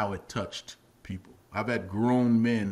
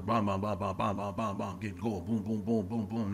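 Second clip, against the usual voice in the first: steady, even run of pounding noises; rhythmic thudding sounds; 3 semitones lower, 4 dB quieter than usual. Retold or imitated. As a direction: thump out a quick exercise beat by mouth. Imitates pounding exercise before the take.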